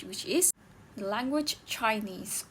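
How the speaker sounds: usual speaking voice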